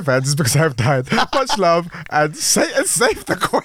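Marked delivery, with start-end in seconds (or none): 1.1-3.7 slow, much higher, breaking into laughter; laughing. Real, not acted. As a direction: giggle your way through the sign-off. Reads sign-off, cracking up.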